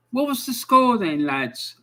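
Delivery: imitated Sheffield local, starting high and dropping on the last word; Sheffield accent. Fella in a car asking away fans the score, Sheffield accent. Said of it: "Sheffield accent, and he's going".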